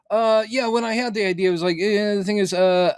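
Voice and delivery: high-pitched mock interviewee voice; Daniel Lindelof. Imitating the writer interviewed on a comedy podcast, high impression. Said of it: "again, that's my impression".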